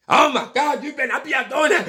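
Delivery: high, fast, hammed-up mom panic; mock the frantic mother; mother's meltdown. Imitating his mother still panicking when he was twenty. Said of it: "still having a meltdown".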